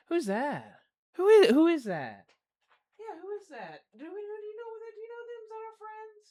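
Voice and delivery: rising, high cooing questions to a pet; excited dog talk. Real, not acted. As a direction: get the dog worked up, sing-song. Talking to the dog.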